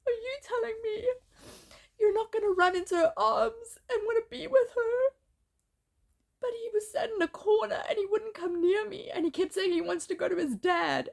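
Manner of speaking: high, tearful breaking voice; pushing words out through real tears; real crying. Real, not acted. Mother describing her son avoiding her, crying. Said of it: "I'm sorry that I'm getting so emotional".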